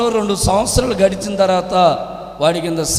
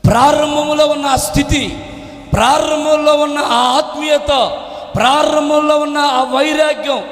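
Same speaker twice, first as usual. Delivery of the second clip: high, sustained preaching voice; impassioned preaching; 9 semitones higher, 3 dB louder than usual. Real, not acted. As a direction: build insistently through the repetitions.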